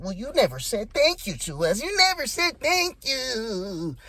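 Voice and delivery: mock triggered whine, high and stretched; hammed-up wounded complaint, slowing on the repeat; Vance. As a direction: imitate someone triggered, pitch soaring. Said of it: "you could tell he was triggered".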